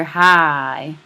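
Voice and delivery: hi stretched out slow in a Southern accent; Southern drawl. Shown as a demonstration. Teacher showing a Southern dialect, hi drawn out in a Southern accent. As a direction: draw hi out long like a Southern speaker. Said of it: "Southern dialect".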